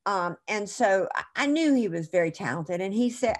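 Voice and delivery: ordinary talk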